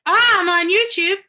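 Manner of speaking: loud surprised call; yelling. Real, not acted. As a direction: blurt it out loudly, surprised. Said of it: "Oh, I'm yelling".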